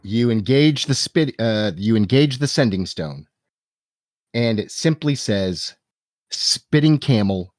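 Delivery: normal talking voice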